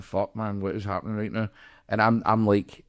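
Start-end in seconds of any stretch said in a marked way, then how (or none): 0.0-1.5 stunned mutter. Retold or imitated.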